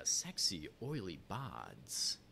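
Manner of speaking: slow, drawn-out sexy radio voice; mock-seductive radio delivery. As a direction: play up a sultry radio-host voice, stretching the words. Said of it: "It's my sexy radio voice".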